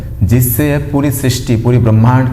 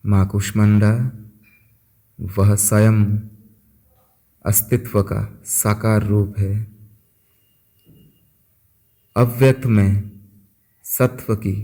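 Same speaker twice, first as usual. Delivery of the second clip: soft, unhurried voice with long pauses; guided meditation; 7 dB quieter than usual. Acted. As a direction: guide listeners gently into stillness, phrase by phrase. (शांत, धीमे स्वर में ध्यान कराते हुए)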